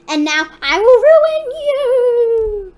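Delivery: slow, rising monster menace; hammed-up villain doom line; villain's threat. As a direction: draw it out, swelling loud and high. Voices monster's threat to players.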